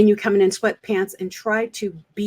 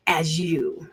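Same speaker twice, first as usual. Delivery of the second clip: whispered; 4 semitones lower, 6 dB quieter than usual. Real, not acted. Coach stressing true self.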